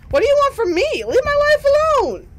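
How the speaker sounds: high, loud frantic plea; desperate protest. Acted. Stan to the ghost haunting him, desperate high shout.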